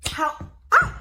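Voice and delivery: startled cry into high laughter; burst of laughter. Real, not acted. Live host laughing at her flying phone. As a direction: yelp then laugh as the phone flies.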